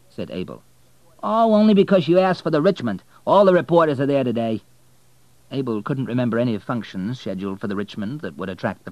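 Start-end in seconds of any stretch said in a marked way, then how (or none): 1.2-4.6 high, loud, chatty cabbie; cab driver voice. Acted. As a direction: voice the talkative driver.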